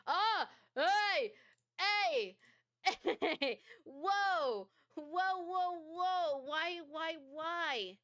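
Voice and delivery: high startled yells; startled yelling. Real, not acted. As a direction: shout in real fright at the jump scare. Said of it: "jump scare".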